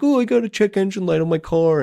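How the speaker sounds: mock a pampered suburbanite's gripe; mock whiny complaint. Mocking suburban car owners' problems, a put-on whine. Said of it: "this is, like, suburban life problems".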